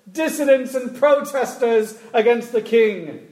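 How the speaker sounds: high, loud proclaiming herald voice; town crier. Retold or imitated. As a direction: proclaim it from a stump, slowly. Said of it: "they say something like this".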